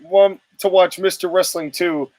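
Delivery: usual speaking voice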